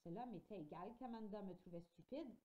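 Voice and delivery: plain reading voice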